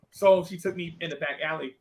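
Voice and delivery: usual speaking voice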